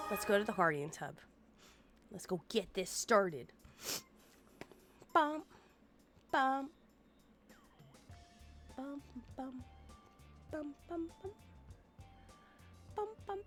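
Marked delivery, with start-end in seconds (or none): none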